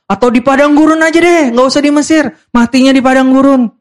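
high-pitched, over-the-top complaint; melodramatic. Acted. Preacher mocks Israelites preferring the desert, melodramatic. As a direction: overdo the grumbling like a drama queen. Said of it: "Lebay banget, benar-benar".

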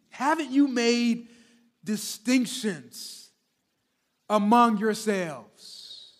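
slow, weighty reading of the verse; slow scripture reading. Acted. Pastor reads verse four to the congregation, slow and weighty. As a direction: lean on each phrase of the verse.

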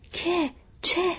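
quick, high-pitched startled questions. A narrator's reading. Frodo rushing round the tree to Merry, startled. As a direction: ask it sharply, pitched high.

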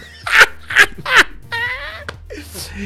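high, squealing laugh; helpless laughter. Real, not acted. After the eyebrow story, high squealing laugh. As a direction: laugh until it almost cries.

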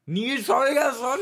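very high, loud, slow laughing disbelief; shocked. Real, not acted. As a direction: shriek the name in shock. Schockierte Nachfrage.

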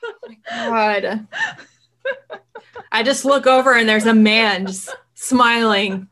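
laughing through leftover fright; shaken laugh. Real, not acted. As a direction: laugh it off, still rattled.

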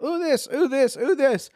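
put-on excited kid. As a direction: point at everything like a thrilled child. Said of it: "when she was going like".

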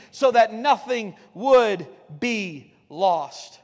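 very slow, weighty drawing out; preacher slowing to a solemn crawl; slow emphatic refrain. Acted. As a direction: let each word land slowly. Sermon refrain slowed for weight.

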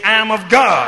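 loud, emphatic declaration. Shown as a demonstration. Preacher getting the congregation to repeat after him, emphatic. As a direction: lead the crowd with a firm, loud call. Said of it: "say it emphatically".

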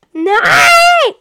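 very high, loud wail of no; shout of despair. Real, not acted. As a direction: cry out in dismay. Losing the round in game.

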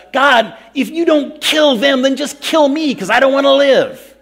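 shouted high and loud in desperation; upset outburst at God. Retold or imitated. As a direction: yell it up at God, furious and despairing. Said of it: "I was so upset with God".

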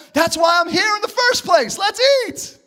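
high, excited shout; eager son. Acted. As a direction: cry it out high, rising to a peak. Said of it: "And the son said".

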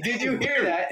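high, loud mocking echo; mocking high imitation. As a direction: parrot his question back high. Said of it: "asked me".

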